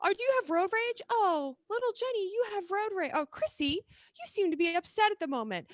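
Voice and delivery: high, syrupy robot nanny voice; mock sweet car AI. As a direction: coo it like a fussy car. Mocking car AI voice.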